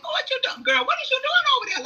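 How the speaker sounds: high, sassy mock girlfriend voice; sassy guides.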